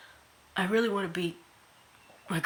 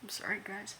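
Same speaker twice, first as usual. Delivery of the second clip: whispered, choked-up sorry; tearful apology; 9 dB quieter than usual. Real, not acted. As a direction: barely get the words out through tears. Crying confession to viewers.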